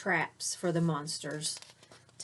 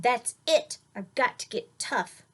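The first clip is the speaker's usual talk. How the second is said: a lightly voiced determined girl, louder; getting tough; 5 semitones higher, 6 dB louder than usual. Winifred fed up with the monsters, lightly voiced tough resolve. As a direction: put some grit into it. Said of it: "She decided".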